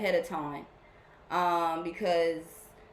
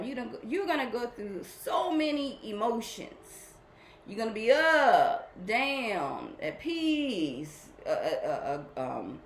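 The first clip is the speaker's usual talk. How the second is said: usual speaking voice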